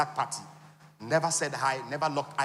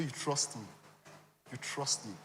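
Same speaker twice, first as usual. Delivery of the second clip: hushed confident promise; 3 semitones lower, 9 dB quieter, slower than usual. Retold or imitated. Friend reassuring.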